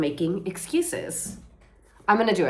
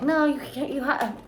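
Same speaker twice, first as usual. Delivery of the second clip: inner voice; 8 semitones higher than usual. Retold or imitated. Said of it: "my brain is like".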